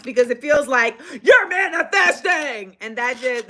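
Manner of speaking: usual speaking voice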